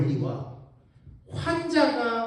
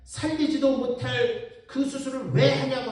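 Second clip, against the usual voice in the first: a higher, slowed mock complaint; retold patient abuse; 6 semitones higher, 4 dB louder, slower than usual. Patient's family berating the ER doctor, mock angry complaint. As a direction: hammer the doctor with mock blame. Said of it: "하는 욕은 항상 응급실 의사가 먹는 거야".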